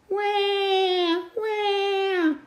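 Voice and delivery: put-on high, sung-out baby wah wah; baby crying. Baby Polly crying at night, high singsong wail.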